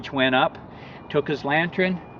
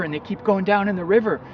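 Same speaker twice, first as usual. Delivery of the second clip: ordinary talk, nothing marked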